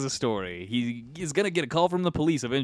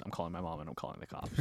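rushed and much softer, half to self; fast panicked thought; 6 semitones lower, 16 dB quieter, faster than usual. Retold or imitated. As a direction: blurt the thought quickly under the breath. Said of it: "my first thought was".

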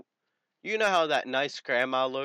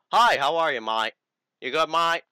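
cheery greeting; 4 semitones higher, 8 dB louder than usual. Retold or imitated. Retold cheery reply to stranger.

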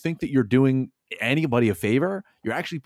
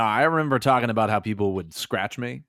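ordinary talk

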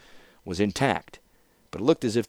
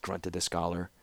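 plain reading voice

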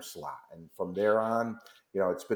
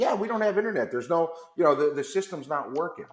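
breezy venue manager; 7 semitones higher than usual. Retold or imitated. Venue head explains outage.

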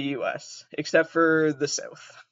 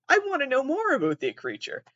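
mock wonder; 10 semitones higher than usual. Acted curious reaction to question.

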